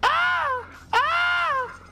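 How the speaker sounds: high yelling scream; panicked scream. Real, not acted. Hit by boss in game.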